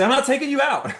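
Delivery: high-pitched holler across the court; hollering coach. Retold or imitated. Dad on the sideline hollers at his exhausted son, high-pitched. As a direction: yell it from the sideline. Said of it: "I'm hollering at him on the court".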